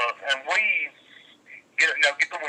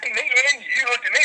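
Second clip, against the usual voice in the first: normal talking voice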